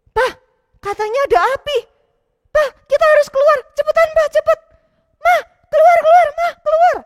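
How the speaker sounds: put-on high, loud, fast panicked child; urgent shouting boy. Matthew urging Papa and Mama to get out of the burning house, panicked. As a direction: hurry your parents out of the house.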